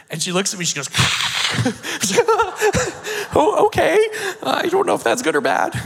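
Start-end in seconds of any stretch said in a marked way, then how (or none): 0.9-5.7 much higher and louder, bursting with laughter; laughing reply. Retold or imitated. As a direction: laugh through it, amused and unsure. Translator laughs at the command.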